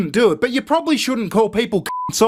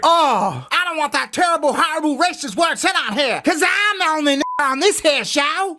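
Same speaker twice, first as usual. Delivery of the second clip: high-pitched mock outrage; hammed-up imitation of an indignant man; mocking panelist impression; 7 semitones higher than usual. Video host mimics the panelist blowing up, high mocking outrage.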